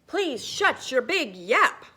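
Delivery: loud, slow exasperated yell; fed-up crowd. Acted. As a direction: drag out the annoyance. Townsfolk fed up with Ben.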